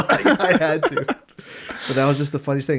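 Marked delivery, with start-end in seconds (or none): 0.0-1.0 high, louder laugh-talk. Real, not acted. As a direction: say it through laughter. Laughing while admitting it.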